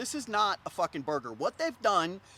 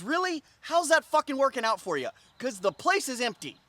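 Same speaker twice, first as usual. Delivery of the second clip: loud, high incredulous rant; exasperated, raised-voice imagined comeback; sarcastic disbelief; 9 semitones higher, 6 dB louder than usual. Retold or imitated. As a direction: challenge him with open sarcasm. Imagined sarcastic reply to the host.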